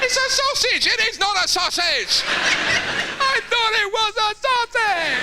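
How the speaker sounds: put-on high pleading child voice; childish squeal. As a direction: whine it like a caught child. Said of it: "Who put this dog turd".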